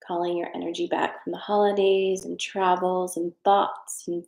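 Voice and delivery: a gentle hint of meditation calm; guided breathing. Leads a breathing exercise.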